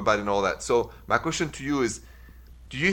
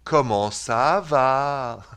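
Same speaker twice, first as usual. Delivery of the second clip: very slow, stretched Swiss French "Comment ça va"; Swiss French accent; 2 semitones higher, 4 dB louder, much slower than usual. Shown as a demonstration. Host teases his cousin's speech, very slow Swiss French accent. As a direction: mimic Swiss French, dragging out every syllable. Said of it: "The Swiss goes".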